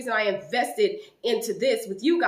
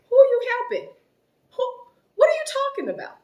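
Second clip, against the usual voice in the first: high, loud, disbelieving cry of who; incredulous high yell; 14 semitones higher, 5 dB louder, faster than usual. Retold or imitated. Retelling a heated call, yelling back in disbelief. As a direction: shout it back at her in disbelief. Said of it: "you the one hollering, not me".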